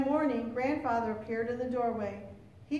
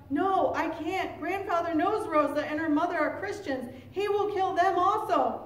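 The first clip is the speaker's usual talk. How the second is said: higher, rising urgency; alarmed realisation; 5 semitones higher, 4 dB louder than usual. A narrator's reading. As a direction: think aloud in mounting alarm. Boy's alarmed thought while fleeing.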